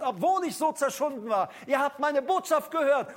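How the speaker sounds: full-force revival preaching. Acted. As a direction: preach it out with fervour. Preaching to congregation.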